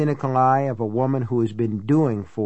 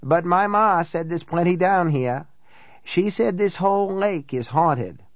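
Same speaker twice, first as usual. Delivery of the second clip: higher-pitched country woman telling ghost lore; folksy, chatty housekeeper, voice pitched up; local housekeeper storyteller; 5 semitones higher than usual. Acted. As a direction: pass on Ma's tales like a local gossip.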